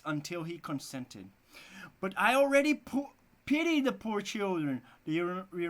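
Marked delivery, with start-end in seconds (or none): 2.0-4.8 lamenting father. Acted.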